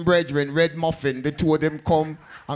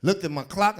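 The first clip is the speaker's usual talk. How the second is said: usual speaking voice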